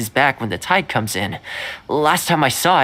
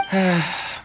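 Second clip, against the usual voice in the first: short falling sigh; resigned sigh; 3 semitones higher, 4 dB quieter than usual. Acted. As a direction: let out a sigh, giving up. Giving up on the dog.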